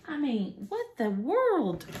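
ordinary delivery

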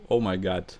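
ordinary delivery

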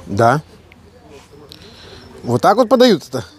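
normal talking voice

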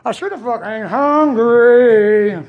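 slowed, drawn-out grumble; slow emphatic repeat. Retold or imitated. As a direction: drag out every word for effect.

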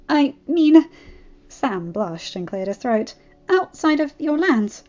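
bashful seasick friend; Sam relieved. Acted.

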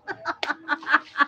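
rippling run of laughter; laughing. Real, not acted. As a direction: laugh off the compliment as a joke. Thinks viewer is joking. (tuloy-tuloy na tawa)

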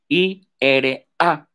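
I R A spelled letter by letter, slowly; spelling out letters. Shown as a demonstration. Teacher spelling I R A slowly to the class. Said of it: "lo voy a tener que deletrear".

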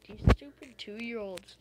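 loud angry outburst at players; angry yell. Real, not acted. Gamer yells at annoying kids in the lobby, angry. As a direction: blast them, fed up.

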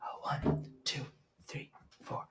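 soft whispered count-in. Shown as a demonstration. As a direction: count them in under your breath. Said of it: "whispered count-in".

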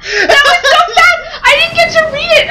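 shrieking, hammed-up despair; mock dramatic wail. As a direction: wail it like a tragedy. Outraged at skipped game intro.

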